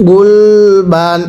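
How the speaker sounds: গুলবান stretched very slowly, ending on a dental n; teacher modelling গুলবান slowly for students; slow drawn-out reading. Shown as a demonstration. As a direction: read গুলবান syllable by syllable, slowly, with a clear final n. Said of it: "একসাথে পড়বেন".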